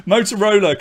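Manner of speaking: ordinary delivery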